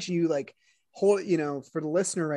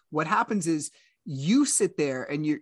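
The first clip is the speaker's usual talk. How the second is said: ordinary talk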